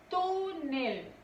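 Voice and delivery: "Túnel" with a long TÚ, then falling NEL; two separated syllables in "Túnel", weight on the first; stressed first syllable. Shown as a demonstration. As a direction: hold the TÚ of "Túnel". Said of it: "Su sílaba tónica es tu".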